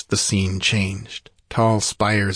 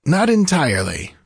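higher-pitched interjection from the sorcerer; breaking in; 6 semitones higher, 3 dB louder than usual. A narrator's reading. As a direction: cut in to correct the king. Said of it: "broke in Peleus".

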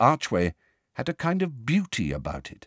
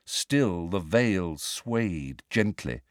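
usual speaking voice

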